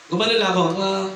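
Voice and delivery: ordinary delivery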